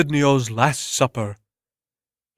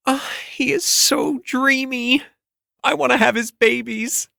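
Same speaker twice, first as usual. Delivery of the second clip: swooning fan; 13 semitones higher than usual. Acted. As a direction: gush over him like a lovestruck fan. Adoring fan gushes over Bert.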